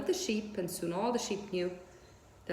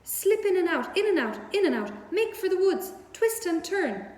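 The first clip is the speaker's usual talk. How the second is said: high, loud honking goose orders; goose crying out; 10 semitones higher, 5 dB louder than usual. A narrator's reading. Goose commanding Wilbur to dodge the farmhands. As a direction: bark commands like a bossy goose. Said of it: "began to give orders".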